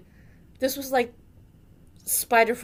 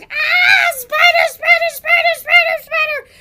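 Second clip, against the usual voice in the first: very high, loud panicked shrieks; retold screaming; 14 dB louder than usual. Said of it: "The three of us are all screaming".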